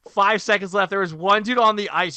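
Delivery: ordinary talk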